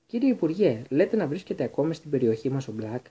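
ordinary delivery

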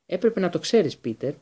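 ordinary delivery, nothing marked

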